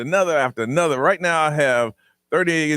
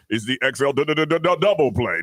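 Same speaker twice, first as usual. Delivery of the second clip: deep announcer voice; 3 semitones lower than usual. Retold or imitated. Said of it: "the guy with the big deep voice".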